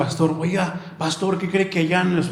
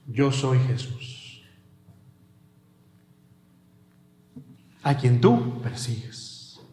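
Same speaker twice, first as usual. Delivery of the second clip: low, slow, hushed divine voice; solemn voice of Jesus; 7 semitones lower, 5 dB quieter, slower than usual. Acted. Jesus answering Saul on the road, low and slow. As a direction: speak low and slow like a voice from heaven.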